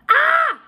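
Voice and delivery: sharp high loud scream of fright. Acted. Boy yanked through the window, screaming in shock. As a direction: yelp as a hand grabs him. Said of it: "he screamed".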